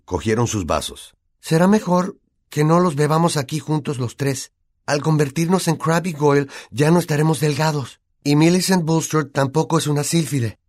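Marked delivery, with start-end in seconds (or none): 1.4-10.6 put-on higher, youthful boy's voice, matter-of-fact; a boy talking his friends through the plan; Harry's character voice. Harry warning Ron and Hermione, in a raised boy's voice.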